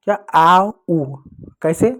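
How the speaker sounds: ordinary delivery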